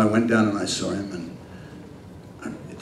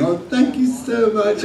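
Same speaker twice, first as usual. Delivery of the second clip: high, slow, sugary mock gratitude; mock gushing thanks; 12 semitones higher than usual. Actor pretending he should thank producer, mock gushing. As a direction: fake grateful, laying it on. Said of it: "I should have been going".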